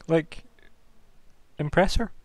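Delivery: ordinary delivery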